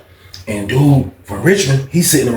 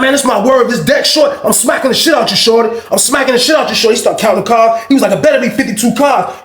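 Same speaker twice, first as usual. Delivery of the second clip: angry threat; 7 semitones higher than usual. Retold or imitated. Threatening the card cheat.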